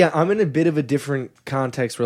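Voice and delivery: normal talking voice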